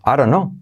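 "I don't know" as "I ron know"; dropped t. Shown as a demonstration. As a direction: flap the d, drop the t in "don't". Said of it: "la T también se omite".

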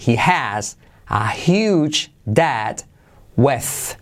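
drawn-out, word-by-word English; slow model sentence. Shown as a demonstration. Teacher translates the bank debt sentence, slow model reading. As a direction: pronounce each chunk slowly for learners. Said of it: "¿Qué ocurre aquí?".

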